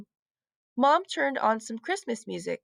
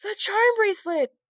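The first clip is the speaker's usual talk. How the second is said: higher, startled cry of discovery; surprised gasp; 9 semitones higher, 4 dB louder than usual. A narrator's reading. Heidi finding the bracelet in the snow, surprised. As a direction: blurt it out on finding it. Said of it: "Heidi gasped".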